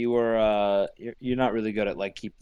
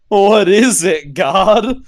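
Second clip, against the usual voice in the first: laughing; 7 semitones higher, 6 dB louder, slower than usual. Real, not acted. Laughing at shocking reveal.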